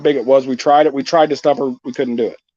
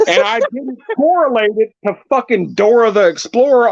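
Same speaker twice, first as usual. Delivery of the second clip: normal talking voice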